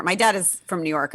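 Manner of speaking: ordinary talk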